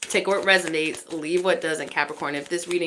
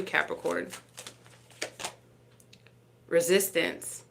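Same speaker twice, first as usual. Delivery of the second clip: ordinary delivery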